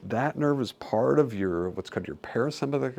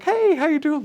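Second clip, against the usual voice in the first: much higher and louder bright hello; cheery greeting; 8 dB louder than usual. Retold or imitated. Relieved patient greeting staff, high and cheery. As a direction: greet like a new, happy person. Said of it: "they're like".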